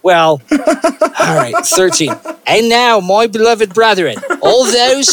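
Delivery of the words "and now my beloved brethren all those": put-on higher-pitched, tentative Aussie accent; attempted Australian accent. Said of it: "in an Australian accent".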